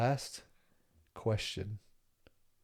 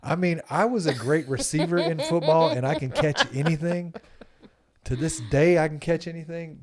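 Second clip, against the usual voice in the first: boastful; 5 semitones higher, 7 dB louder than usual. Real, not acted. Bragging about catching.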